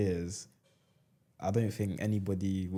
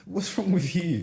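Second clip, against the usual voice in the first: high, louder disgusted disbelief; higher disgusted what's-wrong; judgy disbelief; 10 semitones higher, 6 dB louder than usual. Retold or imitated. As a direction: rise up in outrage. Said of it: "I'm just like".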